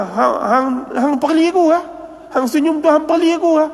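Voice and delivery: high-pitched mock accusation; retold accusation. Teacher accuses smiling pupil of mocking him, retold high and indignant.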